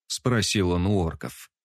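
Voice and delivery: ordinary delivery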